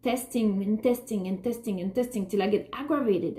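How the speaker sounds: usual speaking voice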